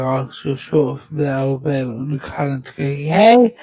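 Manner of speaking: deliberate, slowed-down read. Shown as a demonstration. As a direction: spell it out slowly so it's clear. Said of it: "I'll have to slow that down".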